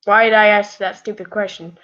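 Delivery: ordinary delivery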